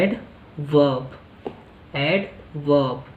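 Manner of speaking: एड वर्ब said as "ad-vub", no r; एड वर्ब as "ed-vob", r dropped; pronunciation demo. Shown as a demonstration. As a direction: say एड वर्ब slowly without the r. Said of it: "आपको क्या बोलना है".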